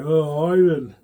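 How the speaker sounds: lightly acted weeping wife; imitating crying wife. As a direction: voice his tearful wife. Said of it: "My wife's crying".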